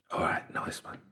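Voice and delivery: hushed, whispered sign-off. Pretend. Host quietly signs off advice to Putin, whisper. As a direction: whisper it softly. Confiding aside to imagined Putin.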